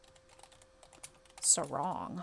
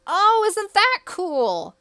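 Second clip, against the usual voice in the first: much higher, louder, slow gushing question; thrilled squeal; 12 semitones higher, 12 dB louder, slower than usual. Real, not acted. Gamer gushes to viewers about the wiki trick, thrilled and high. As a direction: squeal it with delight.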